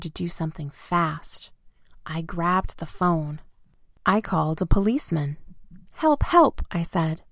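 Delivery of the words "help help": high, louder plea; urgent cry for help. A narrator's reading. Boy phones the policeman for help, urgent high cry.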